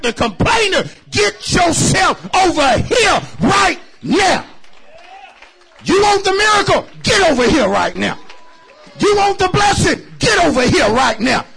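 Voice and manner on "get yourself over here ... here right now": full-force preaching. Acted. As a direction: preach it out loud to the church.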